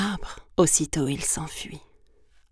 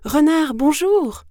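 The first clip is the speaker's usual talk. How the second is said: high, loud, drawn-out greeting; singsong-high mouse hello; mouse calling out; 10 semitones higher, 9 dB louder than usual. Acted. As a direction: hail someone slowly in a small raised voice. Mouse hails the fox.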